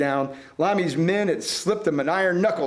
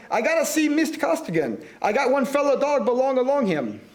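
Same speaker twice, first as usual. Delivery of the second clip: squalling caller; 6 semitones higher than usual. A narrator's reading. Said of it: "somebody was squalling".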